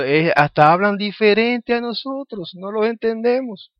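high-pitched imitation of the matriarch; retold complaint, pitched well up; quoting the matriarch. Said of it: "ella dijo".

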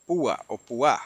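PÚA on the first syllable, then puÁ on the last; both stressings. Shown as a demonstration. Teacher unsure of the Hebrew accent, offering Púa stressed both ways. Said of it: "no he cotejado el acento".

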